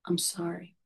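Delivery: soft, hushed apology; very different tone. Retold or imitated. Dying veteran apologizes to his victims' families, hushed. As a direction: let it fall quietly, sincere. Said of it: "in a very different tone of voice".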